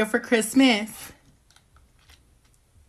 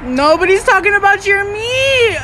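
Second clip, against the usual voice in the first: loud protest; 7 semitones higher, 5 dB louder than usual. Acted. Friend teasing about the meat.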